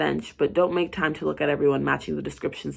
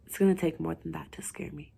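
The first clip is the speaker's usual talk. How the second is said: taunting whisper; 6 dB quieter than usual. Acted. Patient taunting the doctor, soft whisper. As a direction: breathe the dare at her. Taunting her through the door.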